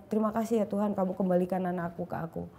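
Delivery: quiet, awed gratitude; tearful thanks. Retold or imitated. Mother hugging her daughter, thanking God softly.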